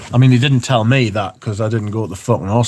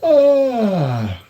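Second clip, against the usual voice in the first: surprised high ah; 11 semitones higher than usual. Real, not acted. Startled mid perfume review.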